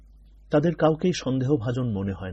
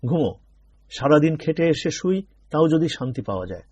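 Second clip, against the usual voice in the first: louder, higher exasperated grumble; irritated outburst; 5 semitones higher, 5 dB louder than usual. A narrator's reading. Tired father snapping at whispering children, louder. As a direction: snap at the chattering kids. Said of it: "বাবা বলে উঠলেন".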